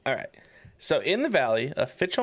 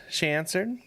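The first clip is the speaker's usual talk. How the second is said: normal talking voice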